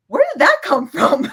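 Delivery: high, loud baffled salesman imitation; incredulous outburst tipping into laughter; astonished.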